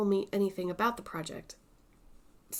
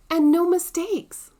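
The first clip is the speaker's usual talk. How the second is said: proud; 9 semitones higher, 9 dB louder than usual. Real, not acted. Proud of stitching.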